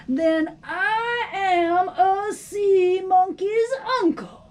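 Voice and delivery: ordinary delivery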